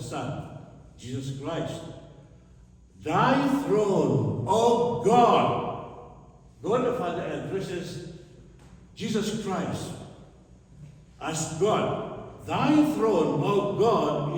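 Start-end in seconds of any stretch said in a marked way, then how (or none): 3.0-5.6 loud, high ringing proclamation; booming preacher's proclamation; proclaimed verse. Acted. 12.5-14.4 proclaimed verse. Acted. Preacher proclaims scripture verse again.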